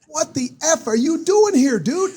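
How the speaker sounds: high, loud, slow disbelief; amazed shout. Retold or imitated. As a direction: shout it in stunned surprise. McMahon surprised on the field.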